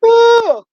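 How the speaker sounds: high, loud yawning oh. Real, not acted. Tired vlogger yawning mid-stream after a bad night. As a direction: yawn it out openly. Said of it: "apologize for the yawning".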